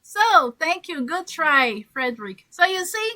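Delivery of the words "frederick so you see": usual speaking voice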